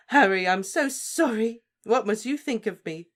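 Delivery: high, loud, tearful voice; shaky. Acted. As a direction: speak shakily through tears. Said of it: "she said shakily".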